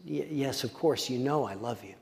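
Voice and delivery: a humbled, chastened Peter barely saying it; quiet voice. Retold or imitated. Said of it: "I imagine in a quiet voice".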